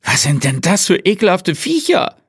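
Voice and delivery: a high, thin voice recoiling in disgust; a shaken colleague backing away from the worms; disgusted Leitner. A narrator's reading. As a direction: squeal it out, horrified by the worms. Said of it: "Entsetzt wich Leitner".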